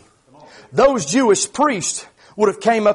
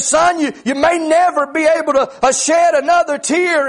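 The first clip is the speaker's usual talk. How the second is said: higher, louder preacher's retelling; ringing, rhythmic delivery of the bad news; preached retold verdict; 8 semitones higher, 4 dB louder than usual. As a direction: lift the doctor's words into full preaching. Same verdict repeated in preaching voice.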